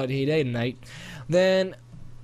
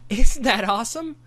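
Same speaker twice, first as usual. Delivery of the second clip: excited; 9 semitones higher, 8 dB louder than usual. Acted. Boy excited about dragons.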